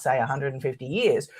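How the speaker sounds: usual speaking voice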